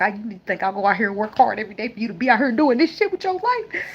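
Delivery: high, pleading mother's scold; upset mother. Retold or imitated.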